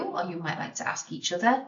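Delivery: normal talking voice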